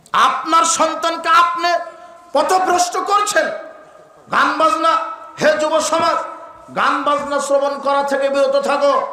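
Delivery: high-pitched, ringing preacher's appeal; urgent, high sermon cry; impassioned exhortation. Real, not acted. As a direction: call out to the crowd in sustained high phrases. Exhorting parents and youth.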